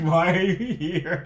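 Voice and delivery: slow, laughing fake rudeness; laughing mock dismissal. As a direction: laugh through a mock brush-off. Retelling rude producers, laughing.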